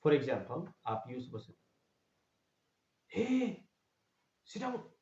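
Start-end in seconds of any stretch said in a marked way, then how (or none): 3.2-4.9 sharp high "हे, सिट down" blurted out; sudden high exclamation. Shown as a demonstration. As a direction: startle them with it, high. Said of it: "हे, अचानक बोल रहे हैं".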